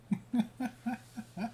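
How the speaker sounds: a sudden high burst of real laughter; amused laugh. Real, not acted. Streamer cracking up at the weatherman's name, high laugh.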